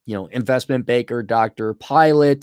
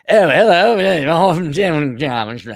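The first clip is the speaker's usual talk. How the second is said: hammed-up British accent, drawn out; mock British accent; 3 semitones higher, 5 dB louder, slower than usual. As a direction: put on an exaggerated British accent. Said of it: "with that British accent".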